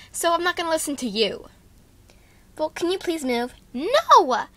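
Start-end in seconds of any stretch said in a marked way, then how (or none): 3.7-4.5 sharp shouted no; loud refusal. Acted. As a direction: yell it right back. Refuses to move.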